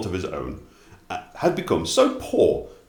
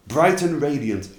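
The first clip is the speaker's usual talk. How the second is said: usual speaking voice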